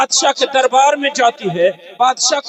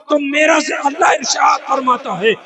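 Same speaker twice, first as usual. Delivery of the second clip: raised, higher preacher's cry; preaching at full force; 5 semitones higher than usual. Acted. Preacher proclaiming God's promise to listeners, ringing out. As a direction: drive the promise home to the crowd.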